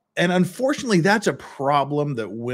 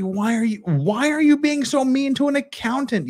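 high, incredulous mock outrage; imitating a baffled viewer, pitched way up; mock exasperated listener; 12 semitones higher than usual. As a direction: squeak it out like a fed-up juror. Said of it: "you're just like".

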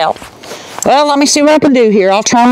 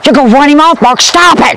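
louder, higher sharp scold; annoyed scolding; 4 semitones higher, 5 dB louder, faster than usual. Real, not acted. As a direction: tell him off for yelling. Scolding Mark for noise.

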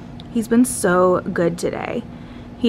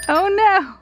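high surprised oh no; 10 semitones higher than usual. Real, not acted. Reacting to a stuck toy.

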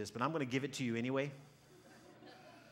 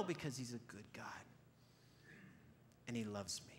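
soft, low, settled answer; quiet certainty; 3 semitones lower, 10 dB quieter than usual. Retold or imitated. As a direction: answer softly, utterly sure.

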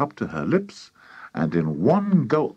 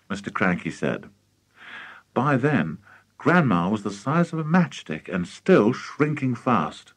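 plain reading voice

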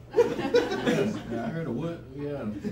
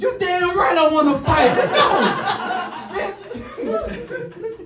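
high, louder, laughing dare; mock-hyped fight call; hyped challenge; 14 semitones higher, 6 dB louder, much slower than usual. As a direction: fire back, laughing, ready to fight.

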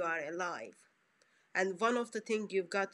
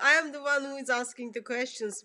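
playfully bossy, louder and higher; teasing mock authority; mock stern; 5 semitones higher, 6 dB louder than usual. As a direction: lay down the rules in jest, laughing. Playful refusal to be questioned.

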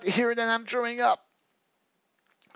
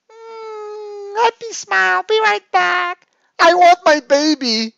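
put-on high, whiny, needy girlfriend voice; clingy girlfriend; 4 dB louder than usual. Girlfriend reading her needy messages aloud in a high whine. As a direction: plead like a lonely girlfriend in a high voice.

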